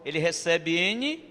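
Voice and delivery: usual speaking voice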